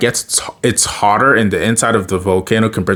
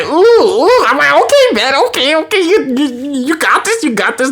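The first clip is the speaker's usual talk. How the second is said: excited cheering. Real, not acted.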